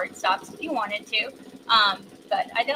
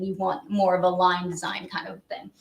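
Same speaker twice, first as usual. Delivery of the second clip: ordinary talk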